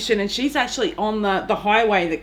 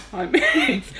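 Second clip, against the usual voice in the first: imitated hello from someone busy typing; distracted repeat greeting. Typing colleague greeting the same passer-by, distracted greeting. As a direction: lift the hello a little, imitating absent-minded politeness. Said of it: "she's typing away and she's like".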